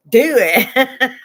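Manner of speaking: loud, high, laughing "do it"; yelled go-ahead. Retold or imitated. Telling the medic to give the fentanyl, a laughing yell. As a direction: bark out permission through a laugh. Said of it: "I was like".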